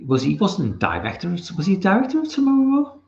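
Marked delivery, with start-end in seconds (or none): 1.6-3.0 surprised. Real, not acted. Surprised question.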